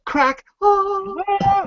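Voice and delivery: high sung angelic ah; angelic choir. Pretend. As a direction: sing out like heaven opening. Sound of angels when poo breaks.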